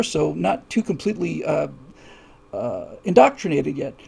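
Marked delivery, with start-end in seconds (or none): none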